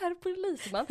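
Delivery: högt och ljust pipigt; härmat bönande. Retold or imitated. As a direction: pipa fram det som ett barn. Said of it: "upprepar vännens imitation". (ljust, pipigt och retsamt)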